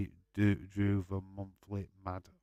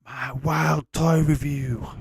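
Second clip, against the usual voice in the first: announcer title voice; 6 semitones higher, 8 dB louder, slower than usual. Acted. Reads out a suggested video title.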